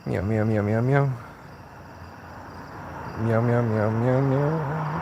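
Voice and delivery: cat noises made at a cat; talking cat-speak to a stray cat; meowing at a cat. Pretend. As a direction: coax the cat with a meow.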